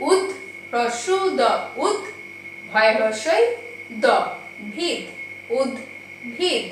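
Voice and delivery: উদ্ভিদ built up slowly piece by piece, উদ then ভিদ; broken into syllables. Shown as a demonstration. Teacher breaking a word into syllables for pupils, slow and deliberate. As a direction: spell out উদ্ভিদ bit by bit, ending slowly. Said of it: "আমি এরকম ভেঙে ভেঙে দেখালাম".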